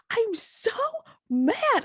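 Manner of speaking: put-on a sulky kid admitting he is angry; child's voice. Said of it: "And he goes".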